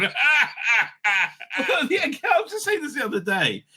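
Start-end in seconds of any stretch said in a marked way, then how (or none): 0.2-1.5 gleeful mock-devil cackle; devilish laugh. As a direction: cackle like a devil amid flames. Flames behind me going.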